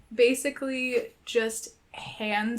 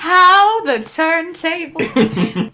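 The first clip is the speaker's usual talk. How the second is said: higher, louder, drawn-out mock pronouncement; playful echo; 6 semitones higher, 6 dB louder, much slower than usual. Co-host echoing a silly joke back, playfully drawn out. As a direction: draw the joke out slowly and loudly. Echoes co-host's joke line.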